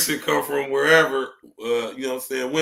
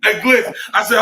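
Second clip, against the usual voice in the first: ordinary talk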